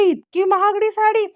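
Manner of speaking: high, surprised gasp of a question; amazed. Retold or imitated. Priya receiving a costly sari, high and amazed. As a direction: marvel at the price, pitch jumping up. Gets an expensive sari gift.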